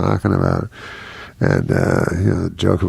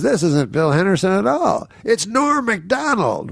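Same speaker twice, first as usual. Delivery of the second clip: a much higher mock announcer, slowing at the name; announcing the reveal; 11 semitones higher than usual. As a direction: ham up the organizer's big unveiling. Organizer unveils the real comedian.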